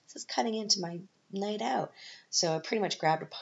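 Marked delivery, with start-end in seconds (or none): 0.1-1.9 annoyed. Retold or imitated. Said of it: "And I was like".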